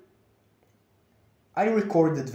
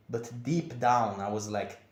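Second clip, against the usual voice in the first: ordinary delivery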